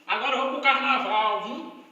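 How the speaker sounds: loud, hyped-up mock recifense; rallying carnival shout in a Recife accent; imitated Recife accent. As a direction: belt it out like a fired-up recifense. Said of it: "O recifense diz".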